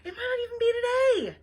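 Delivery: plain reading voice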